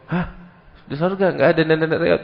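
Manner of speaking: a high, shocked gasp of disbelief; stunned old woman. Acted. Old woman reacting to the Prophet's words, high and stunned. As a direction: play the granny who can't believe her ears.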